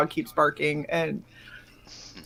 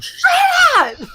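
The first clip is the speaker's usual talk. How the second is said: much higher laughing yell; laughing mock shout; 3 dB louder than usual. Owner yelling at the parrot, laughing and shouting. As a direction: yell it, cracking up. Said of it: "I was like".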